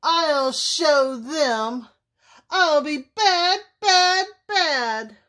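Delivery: worked-up wolf outburst; indignant shouting. A narrator's reading. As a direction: build to a high, loud threat. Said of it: "shouted Big Bad Wolf".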